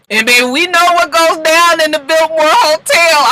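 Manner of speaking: juicy gossip. Real, not acted. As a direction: dish the gossip with a wink. Gossipy insinuation.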